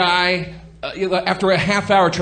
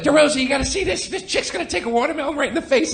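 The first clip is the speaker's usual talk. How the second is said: excited friend; 9 semitones higher, 3 dB quieter, faster than usual. Retold or imitated. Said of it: "Burr's like".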